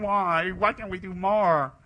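loud, high, drawn-out wail; mock inner lament. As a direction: moan it slowly as a hammed-up complaint. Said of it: "everybody inside is thinking".

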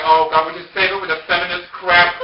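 loud, high, fed-up dismissal; exasperated retort. Retold or imitated. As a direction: brush off her argument loudly. Exasperated retort in acted quarrel.

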